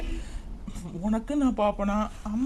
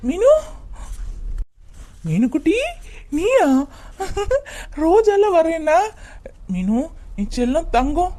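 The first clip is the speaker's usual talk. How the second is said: excited; 6 semitones higher, 4 dB louder than usual. Acted. Husband overjoyed seeing wife again.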